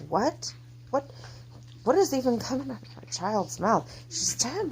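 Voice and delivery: high-pitched, stunned outrage; angry disbelief. Retold or imitated. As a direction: retell incredulous anger, voice climbing high. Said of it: "Oh, I was angry".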